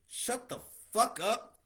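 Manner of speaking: slow, punched-out shut the fuck up; slow hammered command; emphatic telling-off. Real, not acted. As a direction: spell it out word by word. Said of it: "telling twenty-somethings off".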